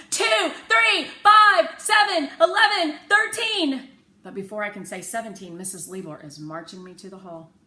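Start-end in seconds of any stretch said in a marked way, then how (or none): 0.1-3.9 much higher and louder shouted count; a child shouting prime numbers in class. A narrator's reading. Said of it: "I stand up and shout".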